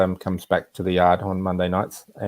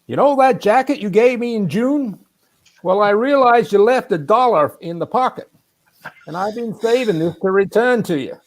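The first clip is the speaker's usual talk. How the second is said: imitated hard-of-hearing man, much higher pitched; Alan's loud talk; 12 semitones higher, 3 dB louder than usual. Friend returning a forgotten dollar, imitated high, loud Wisconsin voice. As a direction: project it high, mimicking a Wisconsin old friend. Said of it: "he speaks quite loudly".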